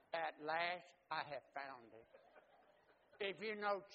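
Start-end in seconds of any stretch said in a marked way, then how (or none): none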